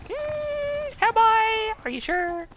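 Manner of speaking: very high, squeaky questioning; squeaky mock doubt. Told they are good at art, squeaky doubtful reply. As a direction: squeak it out as if unconvinced. Retold self-doubt at praise.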